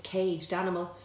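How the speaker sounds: ordinary delivery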